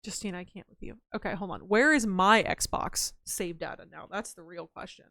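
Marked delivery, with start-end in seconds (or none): none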